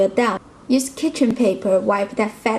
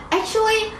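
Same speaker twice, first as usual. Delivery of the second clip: high-pitched actually; 10 semitones higher than usual. Real, not acted. Sudden aside after tasting pork.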